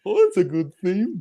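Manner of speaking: high-pitched mock Beethoven voice; imagined Beethoven. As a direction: play a high, comic Beethoven. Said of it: "Death Beethoven going".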